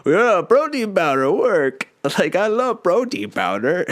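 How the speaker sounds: high, slow, dopey consumer gushing; dumb sheeple voice. Retold or imitated. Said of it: "I'm the sheeple that's like".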